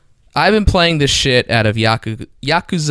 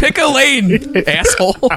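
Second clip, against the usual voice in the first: high mock rant; 13 semitones higher, slower than usual. Real, not acted. Ranting at friend's bit.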